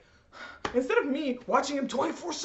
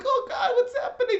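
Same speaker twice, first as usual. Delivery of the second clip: mock panic; 10 semitones higher than usual. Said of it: "Everyone's like".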